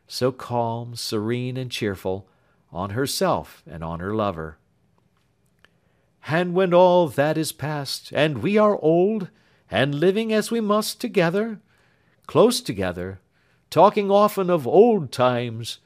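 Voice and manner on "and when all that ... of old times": put-on raised, tender young lover's voice; lightly voiced earnest young man. Young man tells Marian of Grace, tender and higher. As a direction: speak fondly of the absent sister. Alfred imagines old age.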